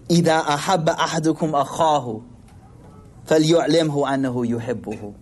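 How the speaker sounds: rapid recited Arabic; quick hadith recitation. Acted. Preacher quoting the Prophet's hadith, recited fast.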